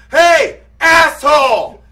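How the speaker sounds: loud, high yell across the street; angry call-out. Retold or imitated. Driver out of his car to raging man, angry shout. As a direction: holler it slow and hard at a stranger.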